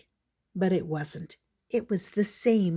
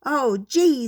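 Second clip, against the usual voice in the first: higher, louder startled peep; 5 semitones higher, 6 dB louder than usual. Acted. Squeak peeping in surprise at the mess, higher. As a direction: let it slip out in surprise. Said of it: "Squeak peeped".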